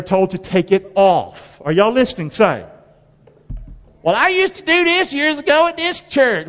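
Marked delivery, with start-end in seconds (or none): 4.0-6.5 higher, whiny imitation of a churchgoer making excuses; a lightly voiced excuse-maker; mock churchgoer excuse. Preacher mocking a lapsed churchgoer's excuse.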